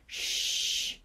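soft, drawn-out library shush; long shush. A narrator's reading. The Brain hushing Buster in the library, shushing. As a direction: hush a noisy friend.

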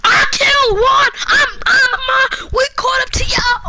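high, loud victory yell; excited shouting. Real, not acted. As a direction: shout it excitedly at the other team. Celebrates a kill in a game.

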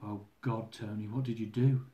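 wife's soft frightened hush as guards close in; scared hush. Retold or imitated. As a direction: voice her dread under her breath, very soft.

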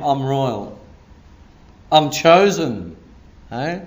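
normal talking voice

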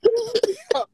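loud laugh. Real, not acted.

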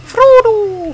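very high, slow hobbit voice; earnest hobbit whine; Sam voice. Pretend. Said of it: "I can't do Frodo".

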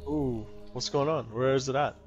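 ordinary talk, nothing marked

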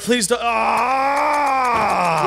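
high, desperate begging then a cry; panicked pleading. Real, not acted. Pleading as character gets hit.

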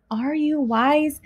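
plain reading voice

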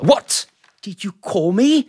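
high, loud startled what; secretary jumps at an imagined insult; indignant surprise. Acted. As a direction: bristle as if insulted. Secretary thinks he was insulted.

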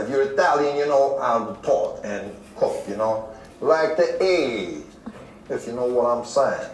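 New York Italian accent. Shown as a demonstration. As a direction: play a wiseguy New Yorker from the films. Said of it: "filmlerde de gördüğümüz gibi".

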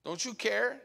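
a touch of panic, rising on care; lightly voiced frightened disciples; understated alarmed cry; mild desperate plea. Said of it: "they woke him and said".

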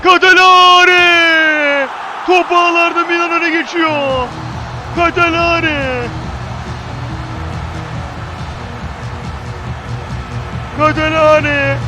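long drawn-out goal scream; screaming football commentator. Real, not acted. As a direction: stretch the name out in a roaring goal call.

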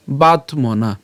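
"বাতমনা" slowly, with a break after বাত; broken into syllables. Shown as a demonstration. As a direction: split "বাতমনা" into বাত and মনা. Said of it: "আমরা করছি এভাবে". ("বাতমনা" ধীরে ভেঙে ভেঙে)

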